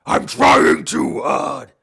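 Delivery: loud, slow strained growl; straining Batman growl. Retold or imitated. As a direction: force the growl too hard. Mocking Bale's strained Batman voice.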